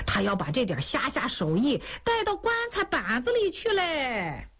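lightly voiced old man egging the girl on; grumbling old man. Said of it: "看哭的老汉就在一边说".

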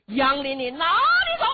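high, loud, laughing yell; laughing battle cry. Acted. Luo Shixin hollering after Yang Lin, high laughing shout. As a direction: roar a mocking challenge.

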